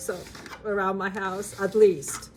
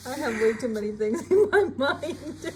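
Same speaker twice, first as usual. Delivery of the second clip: laughing; 5 semitones higher than usual. Real, not acted. Laughing at own slip.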